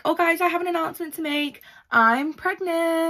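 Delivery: high, gushing imitation of the sister; mock excited announcement. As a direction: rush the lead-in, then stretch the big news loudly. Acting Amy's attention-seeking announcement.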